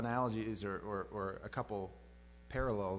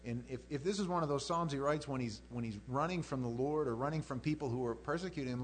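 ordinary talk

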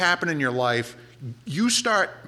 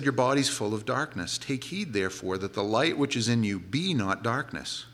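lower, measured King James recitation; a preacher quoting Jesus, solemn scripture reading; 6 semitones lower, 4 dB quieter than usual. Acted.

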